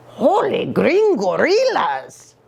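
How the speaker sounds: slow startled gasp; mild mock alarm at a strange creature; little dog spotting an alien, startled; gulped. A narrator's reading. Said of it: "gulped Scapito".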